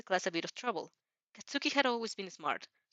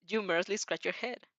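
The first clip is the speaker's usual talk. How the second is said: usual speaking voice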